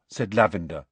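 usual speaking voice

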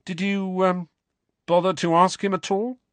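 high, pointed attempt at casual; forced casual. A narrator's reading. Harry asking whether they asked Dumbledore, forced casual. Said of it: "trying hard to keep his voice casual".

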